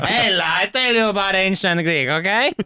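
high, laughing put-on Greek accent; hammed-up Greek lecturer; mock Greek accent. Greek scholar lecturing in a hammed-up Greek accent.

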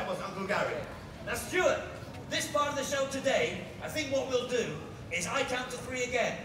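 ordinary talk

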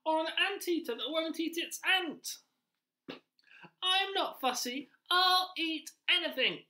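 rat character. Acted. Teacher voicing the pet-shop rat to children, high and loud. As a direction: play the pushy little rat, high and loud.

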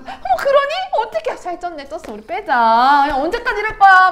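mom in mock dismay. Mom reacting to child's remark on weight, mock dismay. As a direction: play a dismayed mother, starting high and loud.

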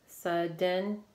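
"Sudden" in two measured beats, Sud, den; syllable by syllable. Shown as a demonstration. Teacher confirming two syllables for pupils, "Sudden" gently split in two. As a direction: say "Sudden" slowly enough to count both syllables. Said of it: "Two syllables".

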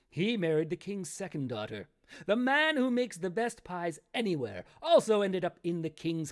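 measured storybook reading voice; story narration. A narrator's reading. Author reading his own grim fairy tale to viewers, storytelling voice. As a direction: read it like a fairy tale to listeners.

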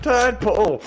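high, loud mock scream; hammed-up shout, pitched high; imitated scream. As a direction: yell it out like the kid. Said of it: "he screams out".

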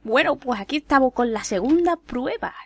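usual speaking voice

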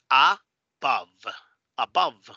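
"above" as uh-BUV, ending on v; native English pronunciation. Shown as a demonstration. Teacher modelling correct native English, careful pronunciation. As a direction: say "above" with English vowels, slowly. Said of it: "correctamente de forma nativa".

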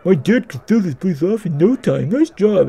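high, loud put-on character voice; party-boy game character. Dudley inviting Max to his party pad, high eager voice.

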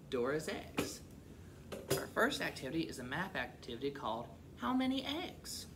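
ordinary delivery, nothing marked

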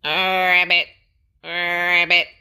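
loud, playful ribbit calls; loud frog croak. A narrator's reading.

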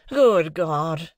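husband crying out in despair; desperate outcry. A narrator's reading. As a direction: burst out in desperation. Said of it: "in an outbreak of desolate desperation".

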